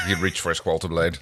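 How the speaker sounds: usual speaking voice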